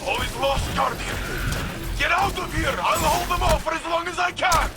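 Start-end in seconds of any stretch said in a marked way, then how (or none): 2.0-4.7 high, urgent shouted command; raised voice, desperate to cover a retreat; urgent battle shout. Acted. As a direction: shout it to an ally under attack. Holding off attackers in battle.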